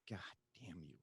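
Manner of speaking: a weary, hushed mock of mom cursing him; a quiet retold curse, mom giving up on her son; mother's quiet curse.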